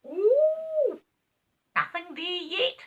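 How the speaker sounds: squeaky, very high rabbit; a tiny creature peering down. A narrator's reading. First rabbit checks the hole, squeaky high. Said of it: "said the first rabbit".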